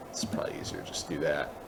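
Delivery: quick whispered aside to himself. Real, not acted. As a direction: whisper it fast under your breath, half to yourself. Muttered aside while working.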